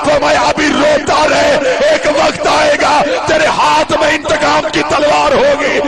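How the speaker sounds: preaching at full force. Acted. Reciter rousing the mourners to weep, with a loud wailing cry. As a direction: build the lament to a crying climax.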